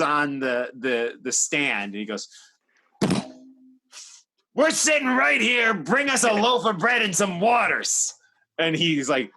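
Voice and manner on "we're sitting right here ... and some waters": loud angry demand, pitched up; yelling. Retold or imitated. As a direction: bark the order at the waiter. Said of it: "Like, he yelled at the guy".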